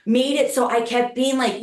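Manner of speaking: usual speaking voice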